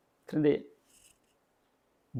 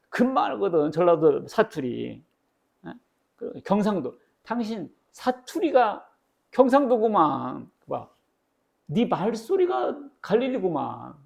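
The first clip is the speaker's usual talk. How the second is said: high, slow teasing call-out; playful servant exposing a dialect; mocking accuser; 5 semitones higher, slower than usual. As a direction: drag it out like catching him by his accent. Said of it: "다른 사람이 와가지고".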